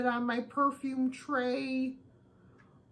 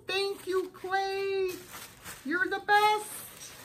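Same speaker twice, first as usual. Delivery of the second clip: high squeaky thanks; cartoon chipmunk gratitude; 10 semitones higher, slower than usual. Shown as a demonstration. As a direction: keep the high chipmunk pitch, slow on the best. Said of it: "and your chipmunk voice".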